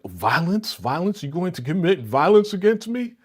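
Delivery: rising, incredulous mock outrage; mock indignant. Paris mockingly shocked at the man's threat. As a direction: play innocent and shocked. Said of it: "Paris, like".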